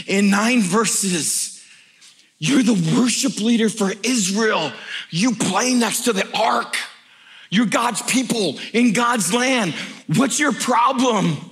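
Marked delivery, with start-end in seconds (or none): 2.4-11.4 slow, high, emphatic scolding; exasperated challenge. Acted. Preacher confronting the worship leader, exasperated challenge. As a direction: hammer each phrase slowly, pitch raised.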